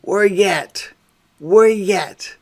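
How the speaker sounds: "We're yet" run together as wer-yet, falling; drawled "We're yet" as the Nawlins greeting; New Orleans greeting. Shown as a demonstration. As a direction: say "We're yet" like a local hello. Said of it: "our own way of talking too".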